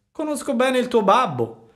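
a fox baiting a puppet; sly fox. A narrator's reading. Fox talks to Pinocchio. (la volpe furba, voce molto più alta e forte)

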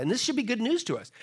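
ordinary talk

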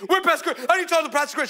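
high, insistent, urgent repeated demand; frantic demand. Retold or imitated. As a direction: imitate a frantic stranger barging in. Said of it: "my sister, she didn't miss a beat".

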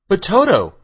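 put-on high, anxious little girl. A narrator's reading. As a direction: raise the voice in mild alarm. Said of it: "said the girl anxiously".

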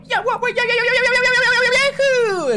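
high squealed yeahs; mock squeaky yelling. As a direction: squeal it like the character. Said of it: "I have to go".

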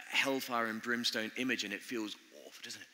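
normal talking voice

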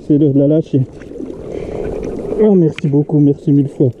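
soft, tender talk to the fish. Real, not acted. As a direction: coo to it lovingly.

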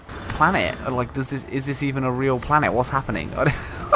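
ordinary delivery

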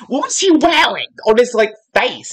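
usual speaking voice

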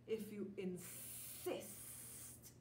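whispered, drawn-out snake voice; slow hissing snake. Acted. Snake answers slowly.

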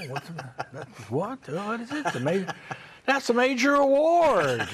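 a loud, gawking southern rube; southern accent. Retold or imitated. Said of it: "I did it in a southern accent".

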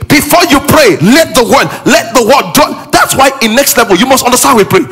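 high, loud preaching; fiery preaching. Acted. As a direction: preach it hard to the congregation. Preaching at full force.

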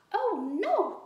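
high loud mock-alarmed cry. A narrator's reading. Girl fakes dismay at the haunt, crying out. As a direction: squeal it in fake dismay. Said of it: "cried Liza Lou".

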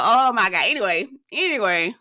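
ordinary delivery

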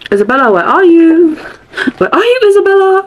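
hammed-up high calling out for a missing person; mock calling out. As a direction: call out as if looking for someone lost. Pretends to search for "Isabella.

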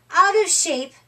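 usual speaking voice

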